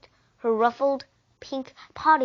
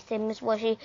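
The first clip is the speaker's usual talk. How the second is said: ordinary delivery, nothing marked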